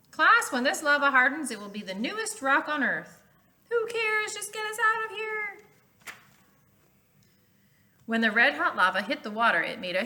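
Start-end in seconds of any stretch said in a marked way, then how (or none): 0.1-3.2 teacher voice. Acted. Teacher lectures class. 3.7-5.6 put-on high, whiny scared kid voice; panicky kid. A frightened student pleading with the teacher near lava, high and whiny.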